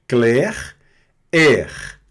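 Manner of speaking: "Clair, air" loud, each ending in a French R; final R pronounced. Shown as a demonstration. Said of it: "Pronuncia o R aqui". ("Clair, air" com o R final pronunciado)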